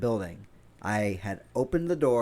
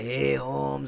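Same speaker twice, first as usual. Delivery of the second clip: joke Spanish accent; 3 semitones higher than usual. Shown as a demonstration. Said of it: "I can't do a good Spanish accent".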